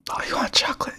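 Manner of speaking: whispered ice cream man; whispered asmr role play. Acted. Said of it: "some A S M R roleplay".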